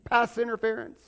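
referee announcement. Retold or imitated. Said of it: "He throws the flag".

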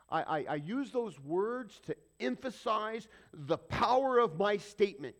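loud, slow, self-important declaration; mock emphatic. Preacher mimics people justifying strong words, mock emphatic. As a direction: hammer out each big word with mock grandeur. Voicing people who defend cussing.

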